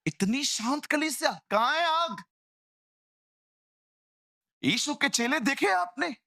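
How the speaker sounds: high, rousing challenge; fiery preaching. Real, not acted. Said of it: "preacher rousing the church". (जोश में ऊँची आवाज़ में प्रचार)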